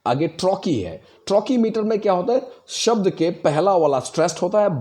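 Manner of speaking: usual speaking voice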